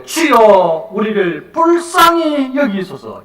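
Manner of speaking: loud, high, drawn-out cry for mercy. Acted. Blind beggar shouting to the Lord for mercy, crying out. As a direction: shout the plea, stretching each phrase. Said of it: "더욱 소리 질러 이르되".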